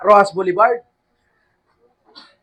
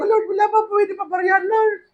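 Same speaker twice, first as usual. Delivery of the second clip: high, pleading mock sob to the Lord; crying plea; 12 semitones higher, 4 dB quieter than usual. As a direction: whimper it up high like a crybaby. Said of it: "Iyak-iyak ako".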